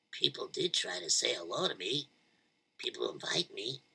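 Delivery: soft whisper; whispered realization. Acted. Fabisna admitting his own fault, whispered. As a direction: breathe it out to yourself.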